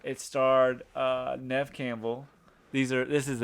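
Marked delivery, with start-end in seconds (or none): none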